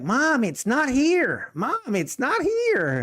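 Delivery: put-on high, slow kid's whine; high, drawn-out mock child complaining; whiny son. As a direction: play the eleven-year-old pleading that it's missing. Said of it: "He's like".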